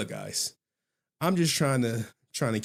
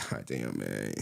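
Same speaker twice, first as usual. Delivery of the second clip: weary low grumble; lowered voice, worn-out reply; low, soft mock groan; 8 dB quieter, slower than usual. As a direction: drop way down and sigh it. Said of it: "I'm like".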